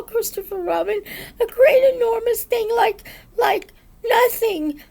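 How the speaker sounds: high, loud, flustered little voice; Piglet in a panic. A narrator's reading. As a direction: stammer it out, frightened and searching for words. Piglet describes the monster in panic.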